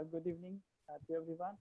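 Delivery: very soft, faint greeting; barely audible. Real, not acted. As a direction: greet the room far too quietly to be heard. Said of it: "can you speak a bit louder".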